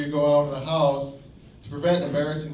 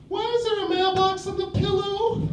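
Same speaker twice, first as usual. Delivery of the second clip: very high sung falsetto question; woman's voice. Acted. Woman asks about mailbox on pillow, high sung falsetto. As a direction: sing it like a puzzled woman. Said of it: "she'd say".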